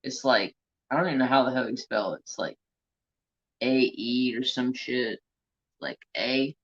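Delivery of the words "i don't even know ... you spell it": ordinary talk, nothing marked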